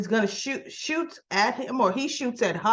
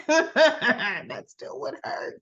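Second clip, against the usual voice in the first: slowly, through laughter, that still would hurt; laughing; 5 semitones higher, much slower than usual. Real, not acted. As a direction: say it while cracking up.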